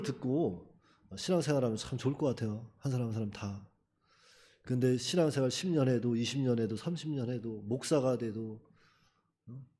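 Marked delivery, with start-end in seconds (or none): none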